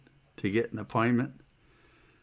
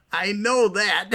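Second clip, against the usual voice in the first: higher, mildly exasperated comeback; exasperated retort; 8 semitones higher, 4 dB louder, slower than usual. Retold or imitated. Patient answering Mary about the hole, exasperated. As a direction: retort as if it were obvious.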